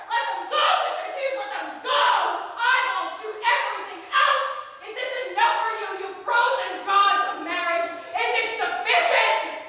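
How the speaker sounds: high-pitched, frantic cry of torment; anguished shouting. A narrator's reading. Oedipus weeping and challenging the gods of marriage, shouting. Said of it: "he shouted".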